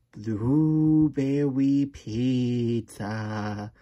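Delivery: slow, drawn-out goofy declaration; silly book voice. Acted. Reading a silly book to a child, slow hammed-up voice. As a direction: stretch every word out theatrically.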